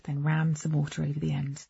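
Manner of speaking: ordinary delivery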